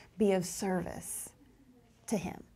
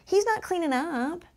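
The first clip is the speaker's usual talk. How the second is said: high whiny tattler, pitched up; tattling child voice; 8 semitones higher than usual. Retold or imitated. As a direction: imitate a child tattling to the teacher. Teacher voicing tattling child.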